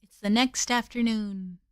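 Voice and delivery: usual speaking voice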